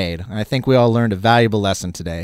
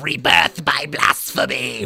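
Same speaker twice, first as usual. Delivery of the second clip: drawn-out cartoon villain voice; hammy arch-villain announcement; Skeletor. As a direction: proclaim the title in a harsh villain rasp. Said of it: "have to say it every time".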